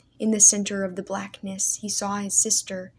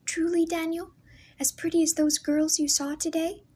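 put-on higher, girlish sister asking shyly; a young girl hoping to be told she is pretty; lightly raised girlish voice; voice of young sister Leah; 9 semitones higher than usual. A narrator's reading. Leah pleading for reassurance.

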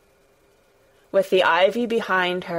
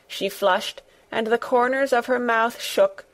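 ordinary delivery, nothing marked

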